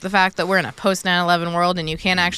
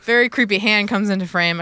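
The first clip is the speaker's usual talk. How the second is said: ordinary talk, nothing marked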